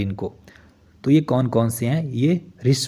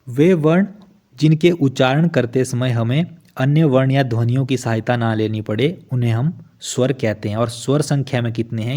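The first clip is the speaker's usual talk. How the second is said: ordinary talk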